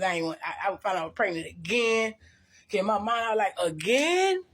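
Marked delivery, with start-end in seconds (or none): 3.7-4.4 high, rising retold disbelief; incredulous. Woman recalling learning she was pregnant again, incredulous high question. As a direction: swoop up sharply in mock shock.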